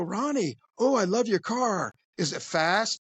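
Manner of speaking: high, gushing creep voice; South Park creep. Retold or imitated. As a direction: coo admiringly like a cartoon creep. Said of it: "That's how he talked".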